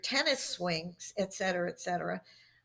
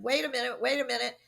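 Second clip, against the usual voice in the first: retold interruption; 4 semitones higher, 4 dB louder, faster than usual. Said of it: "I knew not to pound my hand on the desk".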